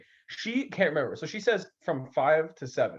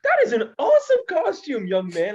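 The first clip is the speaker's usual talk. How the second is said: much higher, louder gushing mom voice; gushing soccer mom; 10 semitones higher, 6 dB louder than usual. Retold or imitated. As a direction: gush like a delighted soccer mom. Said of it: "She's like".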